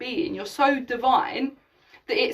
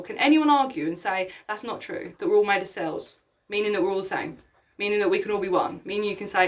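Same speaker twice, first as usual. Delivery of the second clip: ordinary talk, nothing marked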